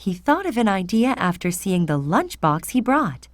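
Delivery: usual speaking voice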